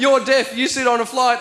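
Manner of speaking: ordinary talk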